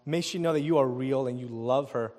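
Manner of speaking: low, earnest prayer voice. Acted. Opens with a prayer.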